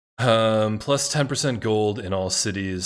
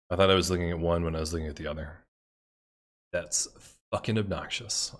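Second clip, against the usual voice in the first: usual speaking voice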